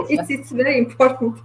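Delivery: laughing through the words; amused, laughing while speaking. Real, not acted. Laughing at own joke.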